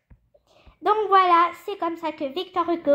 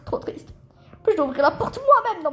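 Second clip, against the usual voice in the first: ordinary talk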